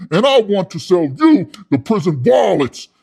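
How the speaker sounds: hammed-up pitchman fervor; big closing pitch. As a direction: drive the sale home loudly. Climax of sales pitch.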